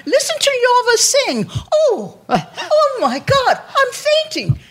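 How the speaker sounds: put-on mother swooning over her daughter's singing, old-movie style; gushing movie-star lady. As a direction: gush like a fainting talkie heroine. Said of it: "they talked to each other like the women in the movies do".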